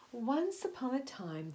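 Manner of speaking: plain reading voice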